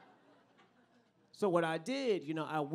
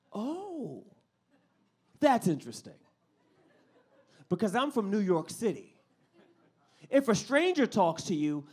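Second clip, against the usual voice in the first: normal talking voice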